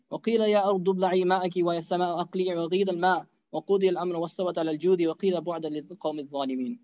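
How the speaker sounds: rapid, flowing Quranic chant; Quran recitation. Acted. Preacher reciting the Surah Hud verse to the listeners, rapid chant. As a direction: run the verse in one melodic breath.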